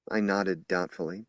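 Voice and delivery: ordinary delivery, nothing marked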